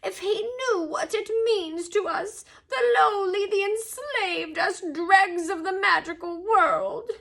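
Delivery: high, drawn-out elf lament; tearful groan. A narrator's reading. House-elf mourning his enslaved kind, groaning. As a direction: plead it high and slow, with tears. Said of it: "Dobby groaned, more tears dripping".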